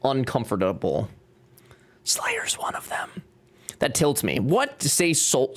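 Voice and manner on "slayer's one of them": high, unvoiced whisper aside. Real, not acted. Whispering a tease about [name] to the others.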